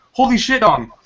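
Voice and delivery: high, loud startled outburst; shocked exclamation. Acted. Greg shocked to see the moon's face. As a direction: blurt it out, amazed. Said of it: "Greg exclaimed".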